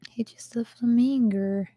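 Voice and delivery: breathy little whisper. Real, not acted. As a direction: whisper it to yourself. Browsing Lego sets, aside.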